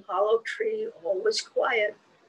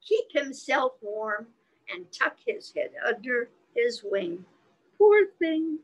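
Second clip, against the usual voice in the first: nursery rhyme recitation; 4 semitones higher than usual. Acted.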